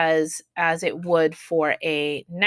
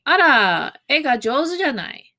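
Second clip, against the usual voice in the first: higher, lively "Ara, e ga jouzu janai" said as a musing compliment; impressed remark seeking agreement; 6 semitones higher than usual. Shown as a demonstration. Teacher demos the compliment to a student, impressed and lightly rising. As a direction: admire the drawing and invite a nod. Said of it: "you're saying".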